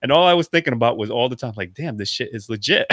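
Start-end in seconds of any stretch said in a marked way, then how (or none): none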